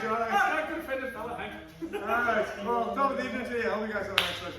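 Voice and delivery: hammed-up Irish accent, high and loud; mock Irish lilt, on request. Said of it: "You should have said it in an Irish accent".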